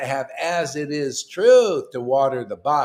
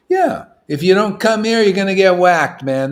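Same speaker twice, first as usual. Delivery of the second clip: louder, higher mock mobster threat; mafia tough guy; 5 semitones higher, 10 dB louder than usual. Preacher imitating a mafia enforcer, loud mock threat.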